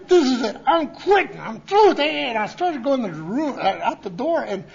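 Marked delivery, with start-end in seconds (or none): 0.1-2.3 mock fed-up outburst. Retold angry quitting thought.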